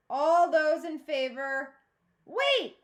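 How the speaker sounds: loud, high, sudden shout; calling out. A narrator's reading. A girl shouts to stop the council vote, loud and high. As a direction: cut in loudly with wait.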